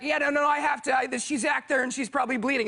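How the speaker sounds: urgent. Retold or imitated. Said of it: "I go like".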